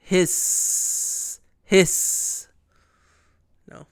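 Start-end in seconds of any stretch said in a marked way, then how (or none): 0.0-2.5 drawn-out breathy snake hiss. Pretend. Imitating the snake.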